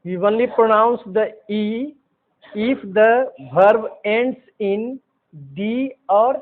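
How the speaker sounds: very slow, each word apart; slow dictation. Shown as a demonstration. Teacher dictating the E rule very slowly. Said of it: "teacher dictating the rule".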